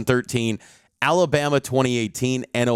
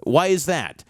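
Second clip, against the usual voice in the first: higher-pitched mock-innocent sarcasm; sarcastically quoting, pitched up in feigned wonder; sarcastic; 6 semitones higher than usual. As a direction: imitate a sarcastic social media jab. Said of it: "kind of sarcastically going".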